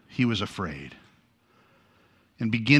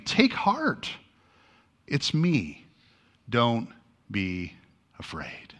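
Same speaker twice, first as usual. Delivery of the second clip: gentle reassurance; 2 semitones lower, 6 dB quieter, slower than usual. Acted. Jesus calming frightened disciples.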